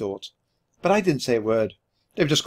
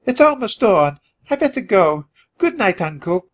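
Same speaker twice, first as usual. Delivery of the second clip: high-pitched lady mouse farewell; Countess calling out; 8 semitones higher, 4 dB louder than usual. A narrator's reading. Countess leaving her uncle at dawn, calling out. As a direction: call it out in a high voice. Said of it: "called Countess Nobella".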